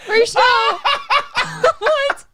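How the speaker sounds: high laughing shriek. Real, not acted. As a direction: squeal through laughter. Laughing at clip.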